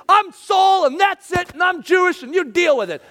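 mock-defiant, in their face; a hammed-up "deal with it" yell; defiant shout.

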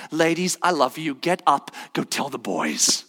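tender Jesus. Acted.

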